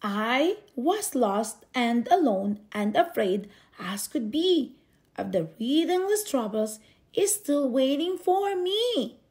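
ordinary delivery

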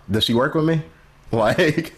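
high laughing disbelief; startled, amused question; surprised laugh. Real, not acted.

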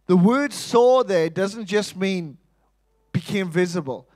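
normal talking voice

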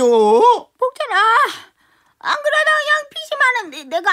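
grieving over her debts, hammed up; lamenting grandma. (빚 걱정에 울먹이는 할머니)

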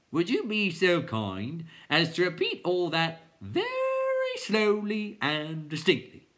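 high, slow, exaggeratedly polite clown; requesting clown. A narrator's reading. Clown asking Bastian to repeat, high slow politeness. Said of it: "the first butterfly clown requested".

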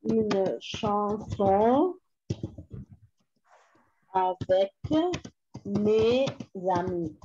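drawn-out words with long gaps; dictating while writing. Shown as a demonstration. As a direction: spell it out aloud word by word.

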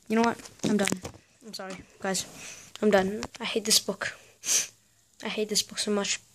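soft, defeated grumble; quietly fed up. Real, not acted. Vlogger telling viewers he's done with the book, quietly defeated. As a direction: give up under your breath.

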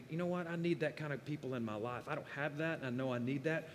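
hushed humble plea; quiet confession. Pretend. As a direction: say it quietly, like admitting a need. Suggested prayer to staff member.

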